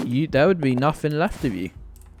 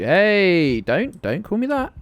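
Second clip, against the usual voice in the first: sudden louder, higher mock outrage; mock indignant protest; 5 semitones higher, 4 dB louder, slower than usual. Real, not acted. Streamer protesting a chat nickname, mock-offended. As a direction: pretend to be offended.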